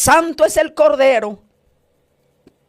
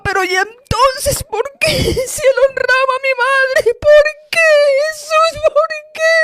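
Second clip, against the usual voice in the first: tearful pleading; 11 semitones higher than usual. Retold or imitated. Pleading with Jesus in hell.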